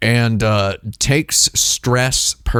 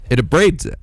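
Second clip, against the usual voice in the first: ordinary talk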